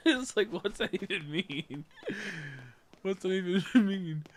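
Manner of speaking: high, loud, breaking into laughter midway; laughing question. Real, not acted. Streamer cracking up at his own silly line. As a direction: laugh through the words in disbelief.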